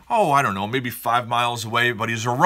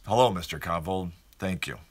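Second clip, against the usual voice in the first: lower, much softer, deflated clerk greeting; humbling greeting; 7 semitones lower, 7 dB quieter than usual. Retold or imitated. Porta potty clerk greets him, low and deflated. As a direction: let the greeting land low and quiet, humbled. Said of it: "the porta potty place know my name".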